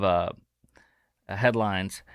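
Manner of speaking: usual speaking voice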